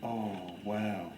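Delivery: soft, low murmur of awe; low, hushed wow; teacher's hushed wonder. Retold or imitated. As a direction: imitate a teacher quietly marvelling at her book. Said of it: "she toned it down a bit".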